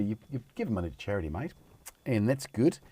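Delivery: put-on a light, half-slipped Aussie accent. Vlogger praising a charity shop buyer, slipping into a light Aussie accent. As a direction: drift into a hint of an Australian accent. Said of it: "I'm doing it in Aussie accent".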